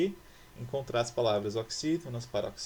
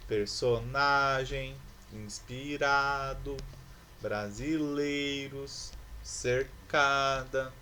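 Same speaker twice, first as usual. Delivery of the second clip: word by word, very slow, stretching the stressed next-to-last syllable of "Personagem, inspirado, brasileiros"; stress on penultimate syllables; 3 dB louder, much slower than usual. Shown as a demonstration. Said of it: "possuem a penúltima sílaba como sílaba tônica".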